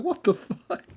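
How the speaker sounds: mock Naruto outburst. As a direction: squeal it out like a stunned cartoon kid. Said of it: "And he's like".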